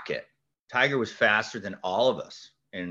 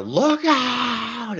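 mock scream; 13 semitones higher, 6 dB louder than usual. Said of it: "And I scream".

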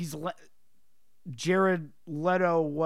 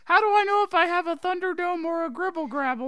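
high, eager schoolkid; curious little student asking teacher; child student voice; 12 semitones higher, 6 dB louder than usual. Acted. Plays a pupil in class skit.

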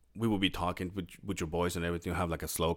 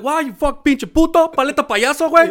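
high, loud hammed-up Spanish insult shout; mock angry Mexican yell; 14 dB louder than usual. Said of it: "They're like".

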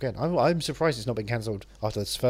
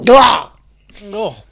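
sudden loud, high "ah" after holding breath; breath-release gasp; 9 semitones higher, 18 dB louder than usual. Pretend. Host ends a breath-holding test, explosive gasp. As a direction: burst out loudly as the held breath goes. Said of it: "seeing how long I could hold my breath".

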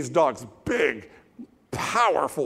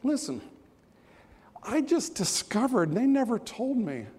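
confiding dog; 8 semitones higher, 3 dB quieter than usual. Acted. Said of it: "he says to you".

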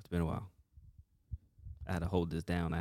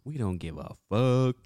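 loud, higher hyped rant; hyped shout; 6 semitones higher, 9 dB louder, slower than usual. Real, not acted. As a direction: fire it up like a hype man.

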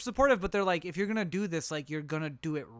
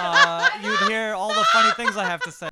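laughing; 4 semitones higher, 13 dB louder than usual. Real, not acted. Joking jab at a friend.

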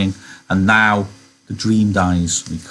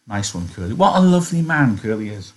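usual speaking voice

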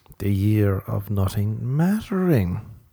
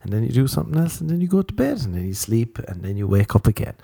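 usual speaking voice

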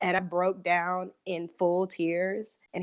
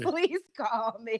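laughing; 2 semitones higher, 3 dB quieter, much slower than usual. Retold or imitated. Laughing through the boot man's quote.

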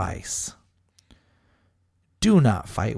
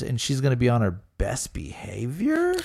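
whispering; 5 dB quieter than usual. Real, not acted. Sarcastic aside.

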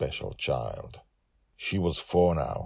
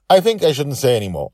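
teasing; 10 semitones higher, 11 dB louder, faster than usual. Real, not acted. Teasing secret to listeners.

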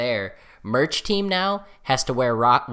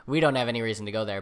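ordinary talk, nothing marked

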